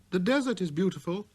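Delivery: put-on high, light boyish voice; a small boy's clear greeting; little prince voice.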